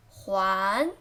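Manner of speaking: 环 with a rising pitch; rising second tone on 环. Shown as a demonstration. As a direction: lift the pitch through 环. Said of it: "second tone".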